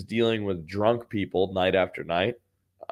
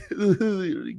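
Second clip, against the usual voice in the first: high, silly tittering; high-pitched mock titter; imitated giggle; 8 semitones higher than usual. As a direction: mimic the friend's giggling.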